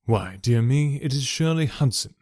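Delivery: ordinary delivery, nothing marked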